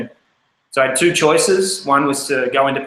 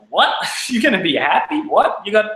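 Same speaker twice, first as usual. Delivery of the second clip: imitating an uncle's laughing disbelief, pitched higher; mock-incredulous uncle, rising questions; 5 semitones higher than usual. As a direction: scoff at the idea, half laughing. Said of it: "he's like".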